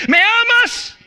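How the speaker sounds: "¿Me amas?" asked high, rising, with weight on "amas"; urgent pleading question. Acted. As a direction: cry out "¿Me amas?" as an urgent plea. Third ask, highest, pleading. (pregunta suplicante y aguda: "¿Me amas?")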